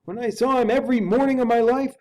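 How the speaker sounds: the loyal servant insisting hotly; indignant butler. Acted. Butler protests to Utterson.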